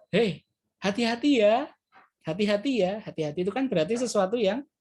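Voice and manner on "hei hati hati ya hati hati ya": friendly warning lifted up high; high caring intonation. Shown as a demonstration. Teacher demonstrating a friendly warning, raised pitch. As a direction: call out a kind hati-hati with a rising tune. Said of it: "Tapi intonasinya juga tinggi".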